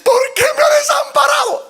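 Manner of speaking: high, loud crying plea; anguished cry. Acted. Son on the cross crying out to his father, anguished. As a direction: cry out forsaken. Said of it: "el hijo le decía".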